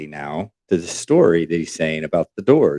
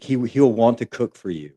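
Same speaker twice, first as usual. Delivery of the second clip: ordinary delivery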